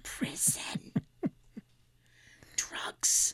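dramatic unvoiced whisper; stage whisper. Shown as a demonstration. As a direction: hiss it like a gossip. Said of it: "exaggerated stage whisper".

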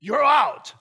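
loud, high Australian verdict; Australian accent. Retold or imitated. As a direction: punch it out in a broad Australian accent. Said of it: "I just didn't get the accent there".

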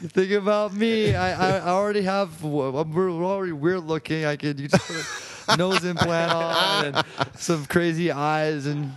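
hammed-up casual pitch; playful plea to J J.

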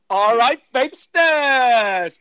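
slow, loud drawn-out vlogger greeting; vlogger catchphrase. Retold or imitated. [name] imitating the reviewer's loud slow greeting. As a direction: stretch it out like the reviewer's intro. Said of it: "everyone once in their life has to follow".

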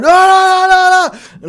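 very high, loud mock scream of no; imitated screaming. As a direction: shriek it like a voice in the hall.

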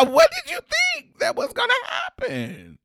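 high shouted scolding through laughter; laughing mock scold. Mocking the nurse scolding him, laughing and shouting. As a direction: yell it while cracking up. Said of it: "looked down at her paper and said".

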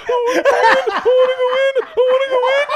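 very high, loud, hammed-up wail; mock uncontrollable sobbing. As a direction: imitate a friend blubbering in panic, half laughing. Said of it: "he is sobbing uncontrollably".

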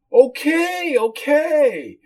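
very high whiny acquiescence; squeaky-high whiny woman; whiny hostess giving in. Acted. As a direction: give in with a high, exaggerated whine. Hostess yields to being refused.

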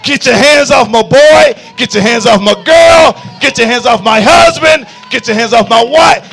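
full-throated high shout, slowing on each command; furious rebuke. Acted. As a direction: roar at the devil to let go of his family. Preacher rebukes Satan from the pulpit.

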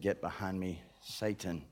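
Jesus rebuking Peter, low and hushed; low stern rebuke. Acted. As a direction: rebuke him quietly but firmly.

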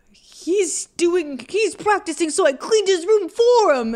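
put-on high whiny kid voice; tattling kid voice. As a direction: mimic the tattling brother. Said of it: "And then he's like".

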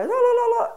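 high, loud la-la-la; mock not-listening la-la. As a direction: sing out la-la as if blocking your ears. Acting out blocking ears to God.

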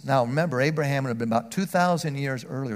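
usual speaking voice

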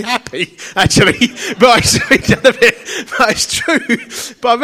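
laughing through the words; chuckling while talking. Real, not acted. Laughing at own joke.

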